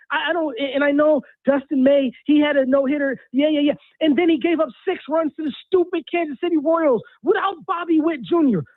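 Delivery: usual speaking voice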